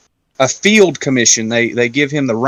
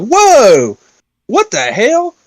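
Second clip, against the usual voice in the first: high, louder startled whoa; mock shock; 9 semitones higher, 3 dB louder, much slower than usual. Watching the crew silence Wesley, high startled whoa. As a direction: pitch up in disbelief.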